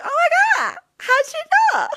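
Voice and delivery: how'd you know, squealed in fake wonder; mock amazement. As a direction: play dumb amazed, squealing a little. Retold mock amazement about costume.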